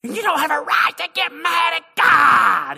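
very high, loud mock preacher scream; screaming preacher impression. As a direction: imitate a hollering preacher at full blast. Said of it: "He's screaming".

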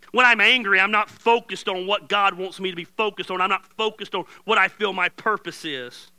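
loud, high preacher's build about anger; fiery preaching. Acted. Pastor preaching to church about going to bed angry, loud and fiery. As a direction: preach it hard to the congregation. Preaching at full force again.

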